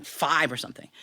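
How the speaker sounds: plain reading voice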